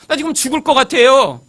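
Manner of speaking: loud, high-pitched desperate plea; desperate inner cry. Acted. Preacher voicing his real feelings to God, desperate outburst. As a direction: blurt out the hidden feeling urgently. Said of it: "사실 속마음은요".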